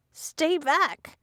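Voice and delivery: high, cracking with panic; panicked voice breaking. A narrator's reading. Cornered girl warns her attackers, voice breaking in panic. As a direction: voice cracks as she backs away in fear. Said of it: "My voice broke with panic".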